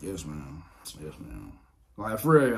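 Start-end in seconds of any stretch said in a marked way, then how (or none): 0.0-0.6 soft, obedient little reply; meek yes ma'am. Retold or imitated. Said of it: "I'm like". 1.0-1.5 much softer, almost swallowed; even meeker yes ma'am. Retold or imitated. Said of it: "I'm like".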